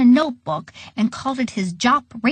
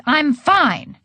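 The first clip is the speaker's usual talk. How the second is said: indignant yell; 4 semitones higher than usual. A narrator's reading. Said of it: "yelled Edward".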